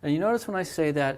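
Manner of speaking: usual speaking voice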